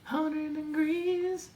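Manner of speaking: high, slow processed voice; warped slow-motion voice; slowed pitched voice. Acted.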